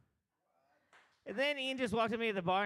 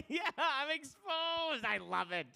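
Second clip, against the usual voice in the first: much higher, breathless put-on voice; mock thrilled guy; 7 semitones higher, much slower than usual. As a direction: play him delighted to be caught out. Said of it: "He's gonna be like".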